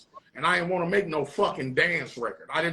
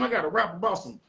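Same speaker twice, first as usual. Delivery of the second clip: ordinary talk, nothing marked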